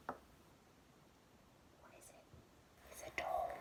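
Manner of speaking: hushed secret reveal; whispered reveal. A narrator's reading. Teacher revealing the door to the children in a whisper. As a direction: breathe it as a secret.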